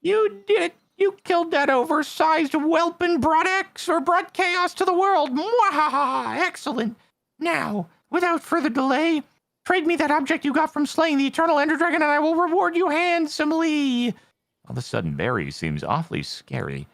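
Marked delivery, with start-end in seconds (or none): 0.0-5.3 high hammy villain voice. Acted. Voicing villain NPC Barry. 5.3-6.4 high, slow hammy cackle; evil villain laugh. Acted. 6.4-14.2 high hammy villain voice. Acted. Voicing villain NPC Barry.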